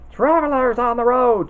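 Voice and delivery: loud, high witch screech; witch's screech. Acted. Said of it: "she screeched".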